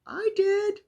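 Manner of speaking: high, loud, triumphant; gleeful high-pitched cheer; excited squeal. Real, not acted. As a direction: squeal it out with joy. Upbeat sign-off exclamation.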